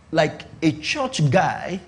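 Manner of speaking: ordinary talk, nothing marked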